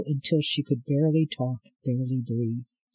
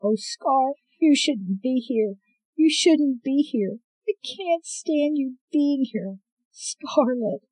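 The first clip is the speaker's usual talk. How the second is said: a hint of a frail old woman, higher and pleading; lightly voiced grieving grandmother; 11 semitones higher than usual. Grandmother begging Scarlet to leave, lightly voiced and distressed.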